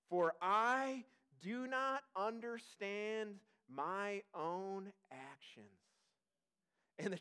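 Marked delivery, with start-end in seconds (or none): 0.1-5.7 very slow, deliberate reading of the verse; slow scripture reading. Acted. Pastor reading Romans to the church, slow and deliberate. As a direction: read it slowly so every word sinks in.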